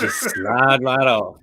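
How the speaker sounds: slow, drawn-out playful innuendo; mock sexy. Host repeats his line on request, mock sexy. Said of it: "can we say that again? That sound kinda sexy".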